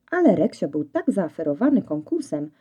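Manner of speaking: ordinary delivery, nothing marked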